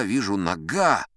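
ordinary delivery

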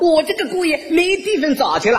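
high, singsong praise; gushing mother-in-law. Retold or imitated. Mother-in-law praises son-in-law to neighbours, high gushing voice. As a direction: proclaim it to the crowd in a high put-on voice. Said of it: "一边夸我".

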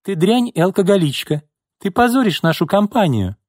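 very high, furious dismissal; exhaled outburst. A narrator's reading. Boss orders secretary out, furious. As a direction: throw her out with a high-pitched insult.

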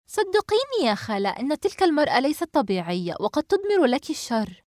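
high-pitched, insistent warning; earnest, emphatic appeal; urgent pleading. A narrator's reading. Worried girl pleads with aunt.

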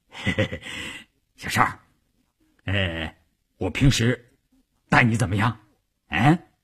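low, ingratiating chuckle into coaxing; shameless wheedling. A narrator's reading. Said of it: "舔着脸说". (舔着脸套近乎)

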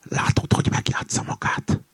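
conspiratorial voiceless whisper. A narrator's reading. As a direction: whisper suspicions to an ally. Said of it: "súgta oda a kakas". (bizalmas suttogás)

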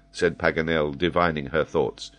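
ordinary delivery

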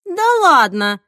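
loud, high burst of disbelief; incredulous outburst; amazed. A narrator's reading. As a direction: exclaim as if you can't believe your friend. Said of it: "изумилась Марина".

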